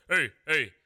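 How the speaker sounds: loud, high mock African accent, shouting; imitating an African coworker's accent, yelling. Coworker imitated arguing with an operator, loud mock African accent.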